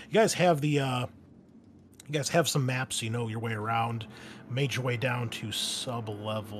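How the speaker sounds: ordinary delivery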